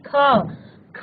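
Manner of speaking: ख sounded out alone, letting the अ vowel ring; isolated ख with an open trailing vowel; letter sound demo. Shown as a demonstration. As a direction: say ख slowly as an aspirated "khaa" for pupils to repeat. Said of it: "उच्चारित कीजिए, अ का स्वर".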